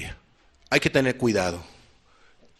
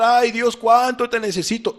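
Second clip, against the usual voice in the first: mock tearful plea; 9 semitones higher, 3 dB louder than usual. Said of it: "Y vuelve a llorar".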